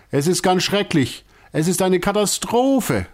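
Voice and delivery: high, wailing lament of a distraught ringmaster; upset circus director. A narrator's reading. Circus director wailing to his gathered troupe, high and distraught. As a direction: moan it like a man at his wits' end. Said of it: "sagt Herr Alasi immer wieder".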